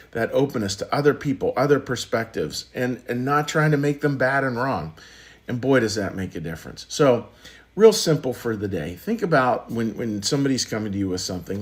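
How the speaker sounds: ordinary talk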